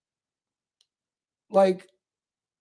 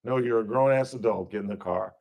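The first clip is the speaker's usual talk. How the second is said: much lower, softer and blunt; gruff cop; 9 semitones lower, 6 dB quieter, faster than usual. Retold or imitated. Officer telling the grown woman to get in the car, low. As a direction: dismiss her flatly.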